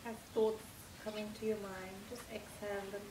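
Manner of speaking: soft, hushed meditation voice with long pauses; guided meditation. Acted. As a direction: lull them gently through a body relaxation.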